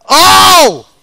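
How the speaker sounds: high, loud "oh" of dismay; loud cry. Real, not acted. Gamer who missed the soul pull, crying out. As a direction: cry out at the miss. Play fails.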